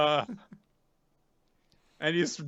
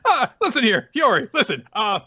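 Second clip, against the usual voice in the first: high, quick, oily moralizing; sleazy sermon; 10 semitones higher, faster than usual. Acted. Orochi preaching to Hiyori against revenge, high and quick. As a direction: lecture her like a slimy hypocrite.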